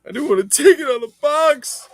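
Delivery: high, loud overexcited cry; emotional outburst. Acted. Host getting emotional about the vintage set, crying out high and loud. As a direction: burst out with mock emotion.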